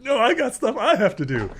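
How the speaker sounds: very high, loud comic retort; mock Kortek. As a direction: squawk back in hammed-up one-upmanship, near laughing. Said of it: "Kortek's like".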